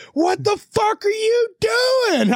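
high, drawn-out imitation of a screaming worker; retold furious holler; vehement screaming. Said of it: "vehemently screaming at me".

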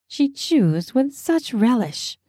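plain reading voice

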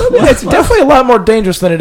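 high, loud, laughing through it. Real, not acted. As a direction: say it while laughing. Laughing while saying it.

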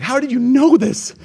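high, slow, amazed question; mock disbelief at dad's knowing; astonished. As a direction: son caught out by his dad, amazed. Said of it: "I'm like".

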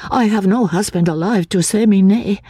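put-on high, timid voice of a fearful girl; frightened young woman. A narrator's reading. Anne, fearing the asylum, asks the narrator in a high timid voice.

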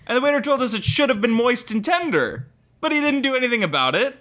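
high, slow, complaining reviewer voice; whiny reviewer voice. Acted. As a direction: read it like an offended customer. Reading one-star review aloud.

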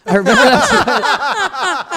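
high, loud, drawn-out laughing cry; laughing recollection. Real, not acted. Guest recalls asking his date about drugs, cracking up. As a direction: shriek it slowly through real laughter.

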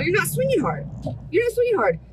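high, insistent mock scolding; retold exasperated correction; imitated coach scolding. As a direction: call out the slackers, pitch climbing on the repeat. Acting out blunt coach scolding players.

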